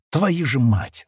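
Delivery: plain reading voice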